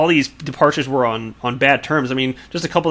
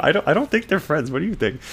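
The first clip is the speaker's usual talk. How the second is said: ordinary talk, nothing marked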